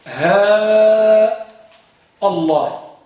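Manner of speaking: drawn-out "ها", a break, then a crisp "الله"; long vowel and glottal stop. Shown as a demonstration. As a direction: stretch "ها" long, then cut into "الله" with a clear hamza. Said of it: "بالمد والقطع". (مدّ «ها» مع قطع همزة «الله»)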